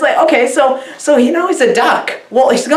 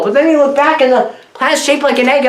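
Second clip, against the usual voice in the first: ordinary talk, nothing marked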